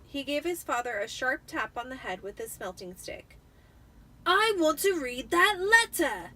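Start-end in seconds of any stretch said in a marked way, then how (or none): 4.2-6.3 higher, loud slow demand; loudly. Acted. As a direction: demand it like a spoiled boy. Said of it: "he said loudly".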